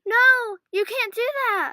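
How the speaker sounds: high, loud pleading cry; desperate plea. Acted. Trapped kids beg the computer villain, loud plea. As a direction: beg them to stop. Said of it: "they pleaded".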